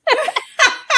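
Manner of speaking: sudden high laugh; laughing at her own story; high burst of laughter. Real, not acted. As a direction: break into a bright laugh. Laughs at husband's reaction.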